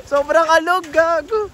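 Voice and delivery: shaken, loud and high; yelling while the bridge sways; on a bumpy road. Real, not acted. Bridge shaking hard.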